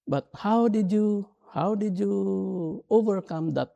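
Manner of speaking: high-pitched wondering question; retold wonder, held high; daughter's amazed question. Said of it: "my daughter Laika asked me".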